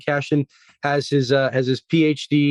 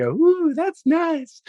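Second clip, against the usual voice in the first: much higher, put-on "ooh" of approval; imitated delighted cooing; 14 semitones higher, much slower than usual. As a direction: coo in a high mock-impressed voice. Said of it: "and I was like".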